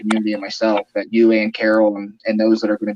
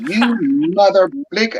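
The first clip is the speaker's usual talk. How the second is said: higher-pitched mock cussing out; hammed-up fury, voice pushed up; retold angry outburst; 7 semitones higher than usual. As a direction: imitate him blowing up, curse censored. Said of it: "he just went off".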